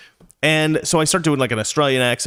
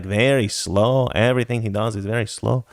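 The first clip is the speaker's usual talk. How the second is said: lower, sluggish cartoon Mexican accent; slow Mexican accent; 3 semitones lower than usual. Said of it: "like, Slowpoke Rod-, Rodriguez".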